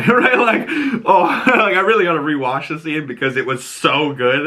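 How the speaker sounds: real delighted laughter; laughing. Real, not acted. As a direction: laugh along while you gush. Laughing over the scene.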